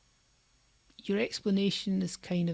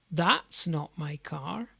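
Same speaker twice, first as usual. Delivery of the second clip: slow, THAT'S picked out, rising at the end; stress on THAT'S; slower than usual. Shown as a demonstration. Teacher demonstrates emphasis to learners, stress on "That's". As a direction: lean on "That's", let the rest follow lightly. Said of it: "emphasising and de-emphasising different parts".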